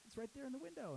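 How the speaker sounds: high excited voice. Retold or imitated. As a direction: point it out eagerly. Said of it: "he's like".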